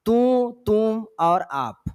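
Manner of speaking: slow, each word set apart; slow listing. Shown as a demonstration. Teacher listing pronoun forms for the class, slow and deliberate. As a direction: spell out the three forms slowly for students.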